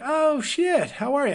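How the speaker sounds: light up at a familiar face; imitating his own startled hello; surprised greeting. Surprised reply on the bus.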